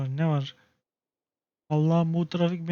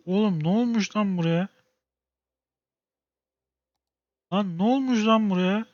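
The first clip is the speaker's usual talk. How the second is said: getting more worked up with each question; rising exasperation; 4 semitones higher than usual. Real, not acted. Player yelling at stuck traffic, growing exasperation.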